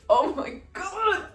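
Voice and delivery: fangirl squeal. Real, not acted. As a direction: squeal it like a thrilled fan. Fangirling over the kiss.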